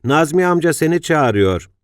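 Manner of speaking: put-on child calling out. A narrator's reading. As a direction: shout it up from the street like a kid. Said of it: "bağırmaya başladı".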